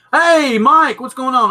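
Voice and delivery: high, loud hey to a newcomer; excited greeting. Real, not acted. Calling out greeting to viewer.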